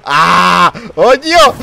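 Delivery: startled high cry with laugh. Real, not acted. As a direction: jump in surprise, then laugh.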